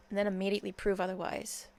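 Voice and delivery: normal talking voice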